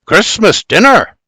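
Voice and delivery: proud, high announcement; crowing. A narrator's reading. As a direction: crow it out like a boast. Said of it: "Bibi crows".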